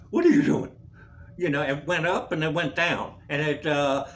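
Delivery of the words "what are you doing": high, loud startled question; mock alarm. Patient to his acting-up heart, mock alarm. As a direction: yelp it in mock panic.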